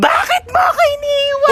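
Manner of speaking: over-the-top mourning scream-cry; crying shout. Acted. Wailing at a grave.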